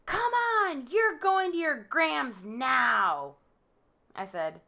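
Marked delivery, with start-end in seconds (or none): 0.0-3.3 high, drawn-out mock yell; retold yelling. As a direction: imitate his yelled order, pitched up and slow. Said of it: "He starts yelling".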